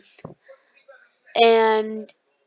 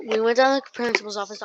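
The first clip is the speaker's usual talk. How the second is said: usual speaking voice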